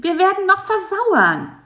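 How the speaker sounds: high nagging insect voice. A narrator's reading. Bisi nagging Karl-Heinz to leave the web, high and whiny. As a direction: complain high and loud, nagging. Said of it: "nörgelte Bisi".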